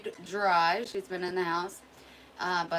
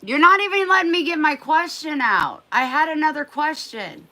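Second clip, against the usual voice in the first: much higher and louder, scolding the dog; exasperated scolding; 11 semitones higher, 14 dB louder than usual. Real, not acted. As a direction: cry out in exasperation.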